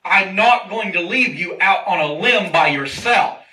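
loud, forceful declaration as God; emphatic promise. Acted. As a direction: drive the promise home, word by word, loudly. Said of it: "God is emphasizing the point".

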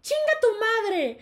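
very high, loud outburst; explosive mock swearing; retold yelled insult. Student cursing his teacher, high shouted insult. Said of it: "se estaban gritando de que".

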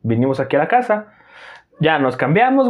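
ordinary talk

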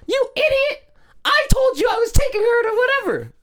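high, loud shrieking mom; mock yelling. Mom yelling at the dad in the store, high mock shriek. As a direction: screech at the husband like an outraged mom. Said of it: "She's, like, yelling at him".

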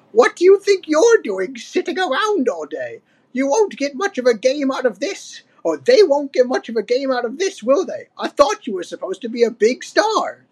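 put-on high-pitched old ape scolding; Cranky. A narrator's reading. Old ape to lounging Donkey Kong, crotchety scolding. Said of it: "said Cranky".

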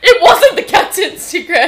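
laughing. Real, not acted. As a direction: laugh through the reveal. Laughing reveal.

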